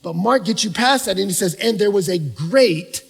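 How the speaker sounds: loud, rising sermon voice; full-force preaching. Acted. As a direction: preach it out to the room with mounting fire.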